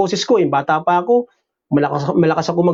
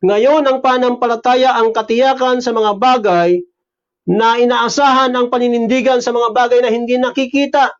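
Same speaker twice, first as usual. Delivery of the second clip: a lightly raised preaching read of the verse; scripture reading; 5 semitones higher than usual. Acted. Pastor reads Romans to the congregation, raised reading voice. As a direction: read the Bible passage out to the congregation, lifted.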